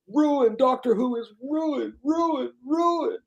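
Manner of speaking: very high mocking wail of ruined; whiny critic. Mimicking critics, he wails ruined at a very high pitch. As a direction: wail it up high like an outraged fanboy. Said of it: "exclamation points, underlines, big".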